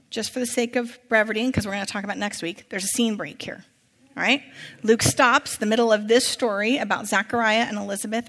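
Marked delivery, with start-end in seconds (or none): none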